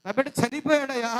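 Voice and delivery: loud grieving wail; mother crying out over her dead son. Acted. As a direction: cry out the loss, beating the chest. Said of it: "పెద్ద కేకలు వేస్తూ గుండెలు బాదుకొని ఏడుస్తుంది".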